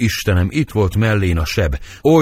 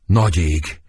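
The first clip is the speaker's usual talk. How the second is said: ordinary talk, nothing marked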